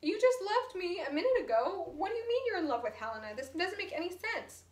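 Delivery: can't believe what she's hearing; upset disbelief. Retold or imitated. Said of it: "She's like".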